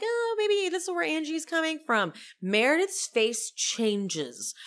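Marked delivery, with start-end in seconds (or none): 0.0-2.1 high-pitched tentative suggestion; lightly mocking, raised voice; Lisa. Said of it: "But Lisa's like".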